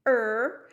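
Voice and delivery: loud creaking "Er" like a branch; crying creak. Shown as a demonstration. Teacher repeating the crying tree sound, loud creaking "Er". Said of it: "That's the sound it would make".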